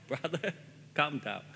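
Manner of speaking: ordinary talk, nothing marked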